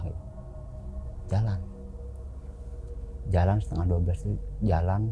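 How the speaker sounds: ordinary delivery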